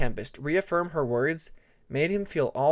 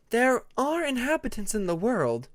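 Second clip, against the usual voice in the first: put-on higher, patient young dragon explaining; Tempest voice, explaining; 9 semitones higher than usual. A narrator's reading. Tempest explaining the dream world to her friends, lightly higher voice. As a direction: lay it out gently for friends, voice raised.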